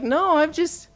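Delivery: high, laughing confession; student admitting he only used the closet light; sheepish laughing. Retold or imitated. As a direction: laugh through the admission.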